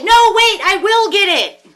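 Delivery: very high, very loud, slowed pleading; mock frantic insistence. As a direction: shout it back desperately, hammed up. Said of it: "turn around and say".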